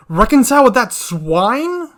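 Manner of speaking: furious king's outburst, loud and high; loud, incredulous royal fury; angry scream. A narrator's reading. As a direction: explode with outrage, voice rising on the question. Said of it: "screams the king in anger".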